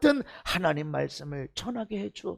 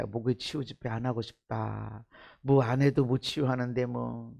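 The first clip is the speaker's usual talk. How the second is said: discouraged murmur; 11 semitones lower, 8 dB quieter than usual. Retold or imitated. Preacher voicing his own discouragement.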